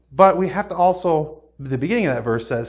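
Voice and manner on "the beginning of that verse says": usual speaking voice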